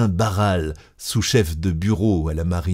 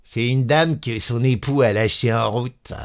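raised, jeering conductor's tone; mocking; 4 semitones higher than usual. A narrator's reading. Conductor explaining the woman to his inspector, jeering. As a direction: report it with a mocking lilt. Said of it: "répondit d'un ton goguenard".

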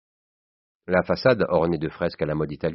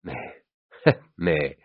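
usual speaking voice

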